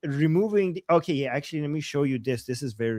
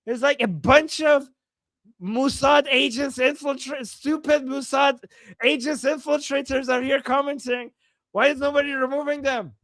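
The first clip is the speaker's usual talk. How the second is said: angry rant; 6 semitones higher than usual. Retold or imitated. Translating angrily in character.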